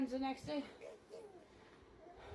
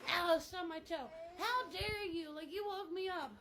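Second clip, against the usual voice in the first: high-pitched, indignant overreaction; mock outrage; 6 semitones higher than usual. Blaming a friend for a stubbed toe, mock outraged. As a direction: act out a hammed-up tantrum. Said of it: "This is how it is nowadays".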